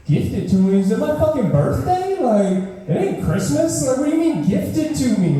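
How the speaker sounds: high, disbelieving rant; incredulous. Acted. Incredulous outraged ranting.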